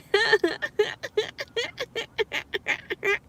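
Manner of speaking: high-pitched staccato giggle; high mock laugh. Speaker laughing at own supposed foolishness, mock giggle. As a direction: let out a long, put-on high giggle.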